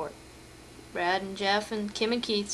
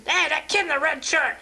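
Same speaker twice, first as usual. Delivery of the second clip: higher, mock puppet voice calling out; 5 semitones higher than usual. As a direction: play the heckling puppet, pitched up. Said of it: "the other says".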